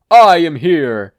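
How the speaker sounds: slow, booming hero shout; All Might yell. Acted. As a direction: roar it like a muscle-bound hero. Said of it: "Izuku yelled with a flex".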